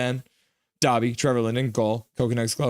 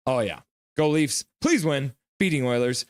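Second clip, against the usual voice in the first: ordinary talk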